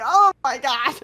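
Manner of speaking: real laughing exclamation. Real, not acted. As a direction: let the laugh take over. Laughing at the memory.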